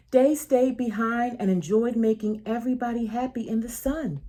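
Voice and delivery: ordinary delivery, nothing marked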